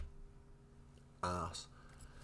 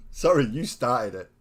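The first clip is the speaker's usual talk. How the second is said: louder, still laughing through it; laughing apology; 5 semitones higher, 13 dB louder than usual. Real, not acted. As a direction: giggle out a mock sorry. Teasing right after laughing.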